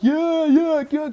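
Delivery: high-pitched imitated crowd cheer. People cheering the truck-top dancer, high excited cheer. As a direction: whoop it up like onlookers. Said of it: "cheering on".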